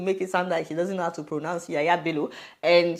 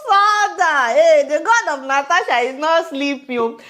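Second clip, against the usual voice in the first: high, loud gleeful cry to God; triumphant outburst, stretched and high; exultant exclamation; 8 semitones higher, 7 dB louder than usual. Real, not acted. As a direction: crow it out loudly, pitched way up. Exclaims over the woman being exposed.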